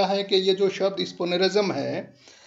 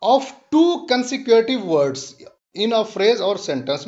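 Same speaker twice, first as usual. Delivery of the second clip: very slow, deliberate reading; slow dictation; much slower than usual. Shown as a demonstration. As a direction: read "a spoonerism is when" word by word. Teacher reads definition slowly.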